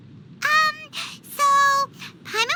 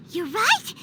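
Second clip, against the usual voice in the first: ordinary delivery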